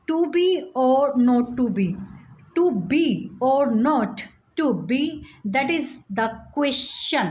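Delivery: very slow, "be" and "not" stressed, pauses between feet; iambic scansion. Shown as a demonstration. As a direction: read Hamlet beat by beat. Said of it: "unstress और stress, one". (धीरे-धीरे, "be" और "not" पर ज़ोर, हर foot के बाद रुकें)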